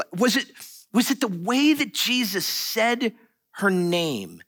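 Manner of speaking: normal talking voice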